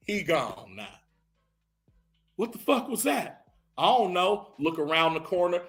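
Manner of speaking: usual speaking voice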